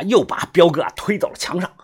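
usual speaking voice

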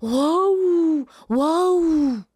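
loud roaring wow-oh like a lion. Acted. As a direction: roar it out big and wild. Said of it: "他就听到一声大吼". (狮子大吼)

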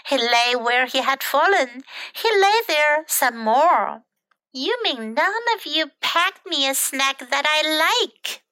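lively, animated picture-book reading; children's story narration. A narrator's reading. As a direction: read it to small children with bouncy character lines.

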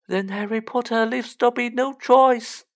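ordinary delivery

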